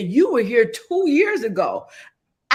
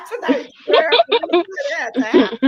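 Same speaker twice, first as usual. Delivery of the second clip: laughing; 7 semitones higher, 7 dB louder than usual. Real, not acted. Host overwhelmed, laughing.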